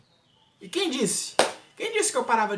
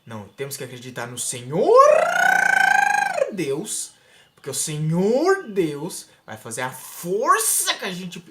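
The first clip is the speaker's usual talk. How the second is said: slow, louder mock sermon; mock preacher; 3 semitones higher, 8 dB louder, slower than usual. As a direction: stretch it out like a preacher straining. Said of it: "Ele fazia assim, ó". (pastor imitado, lento e forçado)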